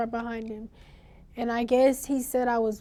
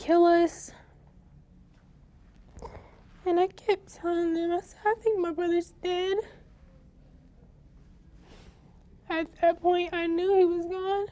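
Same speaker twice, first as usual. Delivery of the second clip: tearful; 5 semitones higher than usual. Real, not acted. Recalling brother's death.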